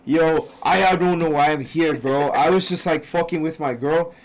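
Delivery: imitating a New York guy in a Bronx accent. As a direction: Bronx accent retelling the guy's story. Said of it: "a whole new Bronx accent".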